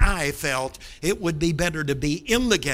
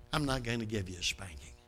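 gentle mercy; 8 semitones lower, 11 dB quieter, faster than usual. Retold or imitated. Father shows mercy.

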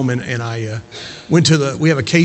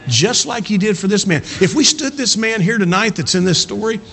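higher, driving pulpit voice; impassioned preaching; 7 semitones higher than usual. Acted. Preacher urging church to keep witnessing, impassioned. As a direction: press it home with rising passion.

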